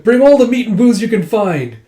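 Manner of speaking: loud, high excited call; rowdy feast order. Acted. Soldiers call for food and drink.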